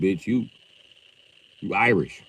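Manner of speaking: ordinary talk, nothing marked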